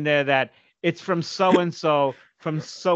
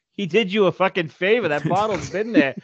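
retold scolding to dad; 5 semitones higher than usual. Son scolding his dad about the old Scotch, mocking. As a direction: tell dad off with a sneer. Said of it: "And I'm like".

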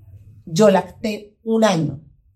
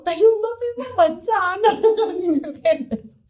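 much higher, slow, laughing in disbelief; exasperated laugh; 13 semitones higher, slower than usual. Retold or imitated. Mother repeating to her son about the apple, laughing and exasperated. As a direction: give up laughing at the absurdity.

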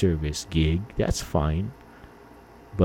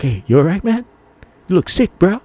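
high, louder concerned call; worried friend; 11 semitones higher, 5 dB louder than usual. Retold or imitated. Friend seeing dizzy Ron in Lahaina, high and concerned. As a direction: call out to a sick buddy.

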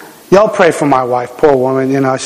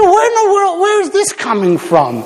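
amazed question; 14 semitones higher than usual. Retold or imitated. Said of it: "I said".

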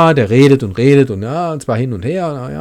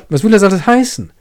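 quick, higher, heated question; Werther exclaims; 9 semitones higher, faster than usual. Acted. Werther protests against Albert's moral judgements, worked up. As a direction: fire it back at Albert. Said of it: "rief ich aus".

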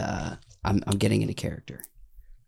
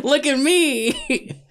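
much higher, slow laughing cartoon cry; Mr. Meeseeks voice; much slower than usual. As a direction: squeal it slowly like an eager cartoon helper. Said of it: "Mister Meeseeks".

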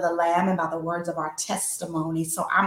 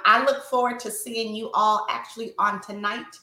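ordinary delivery

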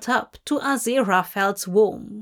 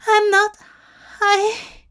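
pained pleading; 11 semitones higher, 6 dB louder than usual. Acted. Dying angel pleading.

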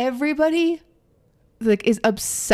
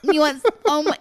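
higher teasing mimicry; mocking imitation; 6 semitones higher, 4 dB louder than usual. Brit teasing the American, high mocking voice. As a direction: tease them with mock disbelief. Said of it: "just their dry humor".

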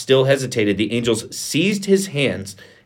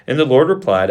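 usual speaking voice

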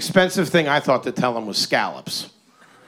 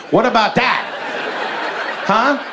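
pitched up, pressing the challenge; mock indignant; 8 semitones higher than usual. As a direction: demand an answer, mock offended. Mock outrage building.

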